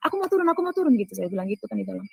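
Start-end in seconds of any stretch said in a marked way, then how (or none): 0.1-0.9 high, insistent repeated plea; retold urgent protest. Woman trapped in the lift begs to go down, urgent. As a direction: re-enact pleading to be let out, pitched high.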